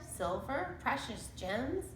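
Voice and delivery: usual speaking voice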